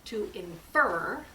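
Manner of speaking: infer drawn out slowly with a rise; stressing infer. Shown as a demonstration. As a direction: lean on infer to teach the new word. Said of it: "use our special powers".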